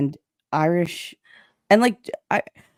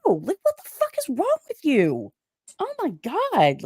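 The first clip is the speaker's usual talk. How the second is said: high-pitched, exasperated retelling; exasperated retort; 8 semitones higher than usual. Retold or imitated. Woman retelling her reply to a prying man, exasperated. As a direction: act out being fed up, pitched high. Acting out exasperated argument over pegging question.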